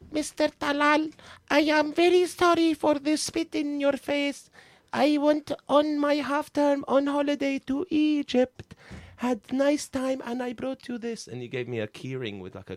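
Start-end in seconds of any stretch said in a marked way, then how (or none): 0.1-11.3 put-on high, slow little boy reciting a sorry; child's apology. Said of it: "and he goes".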